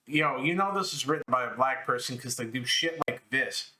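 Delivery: ordinary talk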